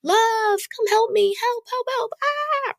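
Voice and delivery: high, frantic calls for help; yelling for help. Retold or imitated. Calling her boyfriend for help as bubbles flood the tub, frantic yell. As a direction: cry out to him to come help fast. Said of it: "I yell to my boyfriend".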